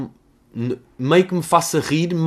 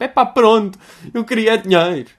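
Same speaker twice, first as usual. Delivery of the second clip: loud, higher put-on admission; exasperated, pitched-up owning up; mock confession; 7 semitones higher, 7 dB louder than usual. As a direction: imitate someone blurting out a confession. Acts accuser confessing.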